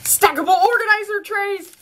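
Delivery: high, thrilled announcing; light up as you name it; squealy delighted reveal; excited. Real, not acted. Delighted naming of the gift.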